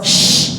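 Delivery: "sh" as a sharp hush; a crisp sharp "sh". Shown as a demonstration. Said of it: "itu bunyinya tajam". ("sh" yang tajam)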